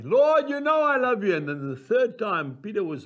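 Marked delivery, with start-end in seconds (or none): 0.0-1.3 earnest plea. Acted. Peter answers again.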